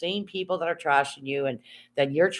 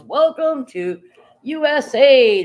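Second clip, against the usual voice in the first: higher, louder, slow mock sat-nav announcer; GPS voice; 10 semitones higher, 9 dB louder, slower than usual. Vlogger imitating her GPS at the border, mock sat-nav voice. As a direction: announce it like a robotic navigation voice. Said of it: "G P S goes".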